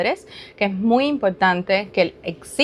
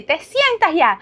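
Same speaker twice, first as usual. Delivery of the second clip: retold yell; 7 semitones higher, slower than usual. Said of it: "y me viré y le grité".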